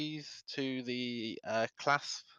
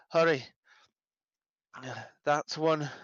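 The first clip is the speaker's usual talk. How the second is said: hurried, raised call to the guards; urgent call; 4 semitones higher than usual. Acted. Player voices character urging guards.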